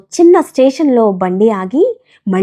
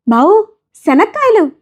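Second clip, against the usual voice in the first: peanut seller's call; 10 semitones higher than usual. Acted. Vendor calling to narrator.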